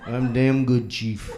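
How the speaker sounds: low impression of a sea-tale teller; Quint. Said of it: "Quint, it's quiet".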